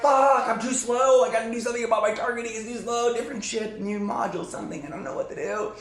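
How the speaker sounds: acted-out battle fluster; flustered panic. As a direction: fumble helplessly under attack.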